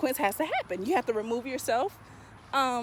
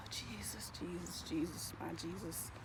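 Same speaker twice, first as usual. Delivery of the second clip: sighed, breathy whispered Jesus; whispered plea; 6 semitones lower, 20 dB quieter, slower than usual. Real, not acted. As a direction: whisper it out as a weary prayer. Sighing, whispered appeal.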